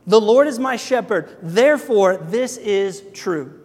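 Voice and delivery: "The Lord is my shepherd" with Lord hit loud and high; stress on Lord. Shown as a demonstration. Pastor to congregation, stressing "Lord" slowly. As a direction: lean hard on "Lord" and slow the rest. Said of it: "here's what David does, and I love this".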